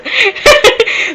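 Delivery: high, real laugh breaking through the story; burst of laughter. Real, not acted. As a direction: crack up mid-quote at how he sounded. Laughs retelling the warning.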